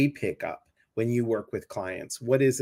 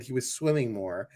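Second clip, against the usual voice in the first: usual speaking voice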